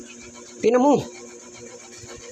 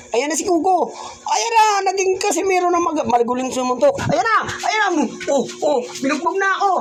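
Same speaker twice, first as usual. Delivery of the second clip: mock alarm; 10 semitones higher than usual. Pretend fight, narrating punches.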